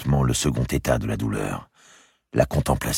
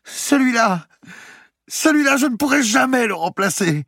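much higher, louder, grief-torn; sobbing young woman; 6 dB louder than usual. Acted. Marie to Madame de Bouville, sobbing over her dead baby. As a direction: let the loss break through.

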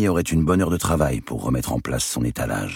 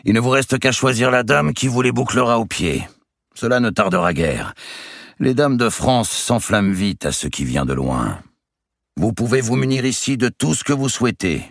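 booming giant; 9 semitones higher than usual. Acted. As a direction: play a swaggering giant of a nobleman. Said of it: "continua-t-il en montrant la galerie".

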